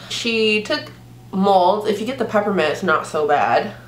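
usual speaking voice

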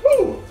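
spicy whoop. Real, not acted. Reaction to spicy noodles.

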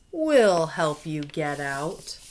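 slow, lightly voiced crocodile; a hint of a friendly crocodile. Crocodile offering help to the monkeys, lightly voiced. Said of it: "One crocodile says".

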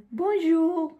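high, loud, put-on French greeting; bad French accent. Vlogger greeting the hotel receptionist, put-on bad French accent. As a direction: sing out a hopeful beginner's French hello. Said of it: "tan mal acento tengo".

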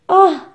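high loud ah of realization; relieved cry. Acted. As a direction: gasp out the discovery. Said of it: "cried the seventh man at last".

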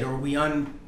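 ordinary delivery

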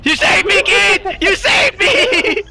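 high, loud joyful yelling; ecstatic shout. Real, not acted. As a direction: shout your thanks in delight. Ecstatic thanks after rescue.

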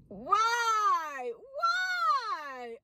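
high squealed why; why, why, rising in pitch; incredulous. Real, not acted. Exasperated rhetorical why.

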